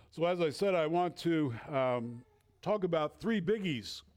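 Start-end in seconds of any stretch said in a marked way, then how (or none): none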